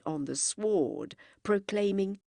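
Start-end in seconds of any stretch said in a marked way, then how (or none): none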